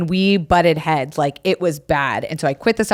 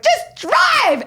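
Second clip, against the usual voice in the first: high, clipped triumphant order. Retold or imitated. Teen telling sister to drive off, high command. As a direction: bark it like a getaway. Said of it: "I was like".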